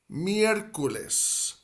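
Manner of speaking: miércoles drawn out, high on mi-ER; careful pronunciation. Shown as a demonstration. Spanish teacher introducing the word for Wednesday, careful pronunciation. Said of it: "we have a four-syllable word".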